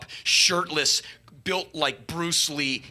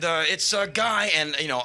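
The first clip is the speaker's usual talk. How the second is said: usual speaking voice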